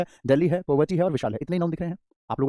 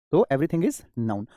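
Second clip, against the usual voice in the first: slow emphasis; much slower than usual. Shown as a demonstration. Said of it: "teacher stresses the key rule".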